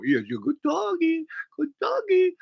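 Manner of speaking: put-on dog baby talk.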